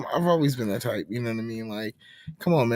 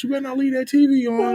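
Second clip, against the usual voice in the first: high, louder mock warning; imitated scolding parent; 12 semitones higher, 5 dB louder, faster than usual. Parent warns child about the TV, mock scolding. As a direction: mimic a parent laying down the rule.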